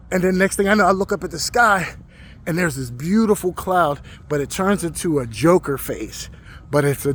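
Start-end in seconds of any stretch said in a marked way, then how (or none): none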